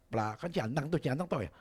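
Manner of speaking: ordinary talk, nothing marked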